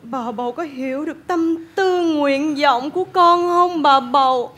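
lightly eerie, slow plea; a hint of a haunted doll; creepy ghost voice. Giọng ma quái tiếp tục. (giọng ma quái nhẹ, chậm)

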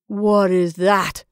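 slow, loud, strange demand. Acted. Henry first sees the shadow, strange voice. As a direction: demand it slowly, word by word. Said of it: "he demanded in a strange voice".

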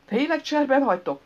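high, loud incredulous question; disbelieving. A narrator's reading. Man being abandoned by his pals, disbelieving.

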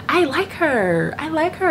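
high, slow, sing-song gushing; drawn out and dreamy, like a crush. Real, not acted. Gushing about a preacher she likes.

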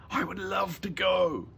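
put-on high, eager boy voice; delighted. Boy delighted by holiday invitation.

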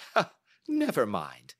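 brief acted laugh into the words. Holmes reassures his startled client, laughing it off. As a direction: brush it off with an amused "ha". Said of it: "said Holmes, laughing".

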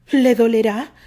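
loud nervous question; nervous worry. A narrator's reading. Terry nervously asks Isaac if it will hurt, loud. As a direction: ask it anxiously. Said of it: "nervioso, preocupado y confundido".

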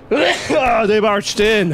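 slow high yelling; drawn-out shout. Shown as a demonstration. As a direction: stretch it into a yell.

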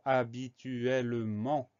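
slow, drawn-out HABITUELLEMENT, each syllable apart. Shown as a demonstration. Teacher introducing a very long word, syllable by syllable. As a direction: stretch out every syllable of "habituellement".

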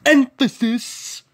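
"Emphasis" stretched out slowly; drawn-out emphasis. Shown as a demonstration. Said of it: "putting emphasis on the word".

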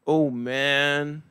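deadpan, no excitement; flat monotone wow. Pretend. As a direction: say wow with zero emotion. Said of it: "hard time reading and showing emotions".

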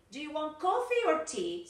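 high-pitched teacher's model, up on "coffee" and down on "tea"; rising then falling intonation. Shown as a demonstration. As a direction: lift "coffee" high, let "tea" fall. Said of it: "falling and rising intonation".

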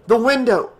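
loud, high urgent exclamation; proclaiming. A narrator's reading. Nick scrambles into the office with Tina, proclaiming. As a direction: call out the escape route, high and loud. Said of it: "Nick proclaimed".

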